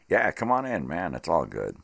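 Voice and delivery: loud, easy welcome. Retold or imitated. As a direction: call them in loudly. Answering the friend at the door.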